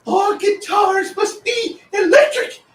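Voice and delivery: high, slow, hammy shouted proclamation; drawn-out comic screaming announcement; mock decree shout. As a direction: bellow it like a mad king's edict. Reads decree meme.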